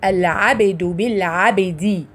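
العبد said slowly with the ba closed then bounced open; slow العبد بالعبد, each ba popping out with a slight echo; قلقلة واضحة في باء العبد; qalqala on the ba. Shown as a demonstration. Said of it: "زي ما تكوني بتقفلي المخرج وتفتحيه تاني".

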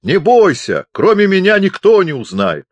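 very high, loud reassurance; bellowing. A narrator's reading. Deaf chaplain assures Pupser nobody will know, bellowing. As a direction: yell comfort at full voice. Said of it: "орал он".

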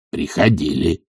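subdued low reply; servant's answer. A narrator's reading. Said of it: "отвечал Захар".

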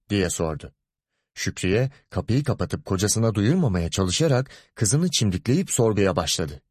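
plain reading voice

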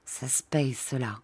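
low murmur, dropping to near silence; quiet threat. A narrator's reading.

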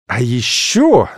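slow menacing opening; ominous. Acted. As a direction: drag it out with a threat. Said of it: "зловеще произнесла я". (зловеще, медленно)

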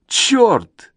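high, exasperated outcry; high narrated yell without full volume; yelled curse. A narrator's reading. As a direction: burst out with the curse at a high pitch. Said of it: "орёт Стивен".

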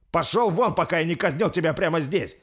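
loud, high furious order; angry shout. A narrator's reading. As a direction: throw him out, shouting. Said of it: "закричал Рольф". (гневный крик)

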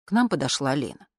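ordinary delivery

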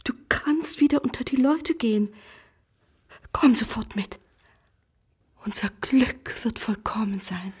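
whispered plea to come home. Acted. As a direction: coax him gently in a whisper. Wife urges husband home.

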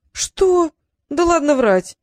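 high, loud incredulous burst; disbelieving. A narrator's reading. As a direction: scoff in disbelief. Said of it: "не поверил Трикс". (не верит, громко и высоко)

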